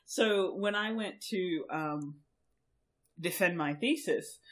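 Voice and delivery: ordinary talk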